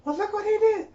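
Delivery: high, mocking voice of the upset mom; imitating mad mom. Mom mad about the son.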